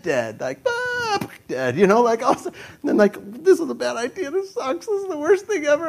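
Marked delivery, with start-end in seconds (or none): 0.7-1.3 very high, short falling cry; mock falling scream. Mimics falling to his death. 3.4-5.9 high-pitched comic wail; mock terrified whine. Acts out terror in the harness.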